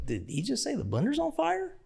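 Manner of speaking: can't believe what you heard; disbelief. Retold or imitated. Disbelief at the news.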